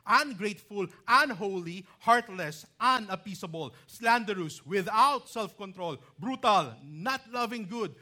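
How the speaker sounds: loud, ringing pulpit reading of Paul's list; scripture reading. Acted. Preacher reading Paul's warning to the congregation, loud and ringing. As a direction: proclaim each vice like a warning from the pulpit.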